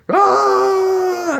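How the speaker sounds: long, high mock war cry; drawn-out high holler; attacker's yell. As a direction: imitate a chainsaw man charging. Chainsaw man bursts out.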